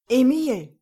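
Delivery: loud call from down the street; shouted call. A narrator's reading. Colleague calls Emil from the next street, loud shout. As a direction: yell his name across the block. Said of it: "hallte es aus der nächsten Querstraße".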